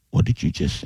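hushed, voiceless mock disbelief; retold stunned question. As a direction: drop to a stunned near-whisper. Cook turns round, stunned by order.

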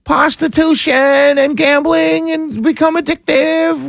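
high, slow mocking tone. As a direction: mimic them scornfully. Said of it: "Don't tell me".

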